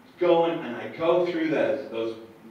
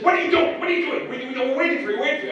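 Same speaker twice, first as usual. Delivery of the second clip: high, loud shrill-free outburst of an angry secretary; livid secretary; 12 semitones higher, 6 dB louder, faster than usual. Retold or imitated. Secretary scolding the late storyteller, high and loud. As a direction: squeal it at him, furious he's late. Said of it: "the secretary is livid".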